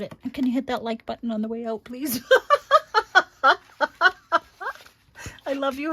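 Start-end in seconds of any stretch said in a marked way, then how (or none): 2.2-3.6 big burst of laughter, much higher and louder; loud laugh at her own request. Real, not acted. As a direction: let out a real delighted laugh. Laughs after asking for likes.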